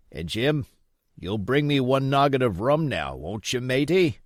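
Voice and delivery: put-on old pirate begging Jim for rum; old sea captain. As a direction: play the sick old buccaneer wheedling the boy.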